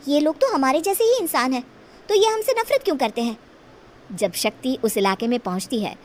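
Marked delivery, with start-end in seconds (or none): 0.1-3.4 put-on higher, quickening puzzled girl voice; wondering young girl. Shakti puzzles over the villagers, higher and faster. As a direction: wonder aloud, rising in disbelief.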